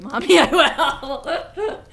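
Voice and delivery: shouted so loud it distorts; clipping distorted mic. Real, not acted. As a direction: yell into the mic till it clips. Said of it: "I'm sorry if that blew out the mic".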